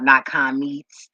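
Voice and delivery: "Not comments." drawn out slowly, each word hit; slow correction. Real, not acted. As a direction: spell out the correction slowly and firmly. Correcting her own typo.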